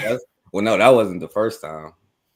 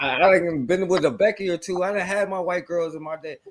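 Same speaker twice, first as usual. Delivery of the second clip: higher, whiny mock of him pleading he belongs; mocking whiny pleader; 10 semitones higher, 3 dB louder than usual. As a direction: send up a man begging to be accepted.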